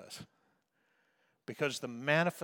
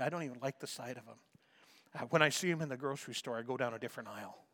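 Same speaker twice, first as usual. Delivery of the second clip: rushed, lower muttered grumble; quick grumbling aside; 3 semitones lower, 5 dB quieter, faster than usual. Pretend. Preacher voicing someone who avoids a person, fast grumble. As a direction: hurry through it like an annoyed confession.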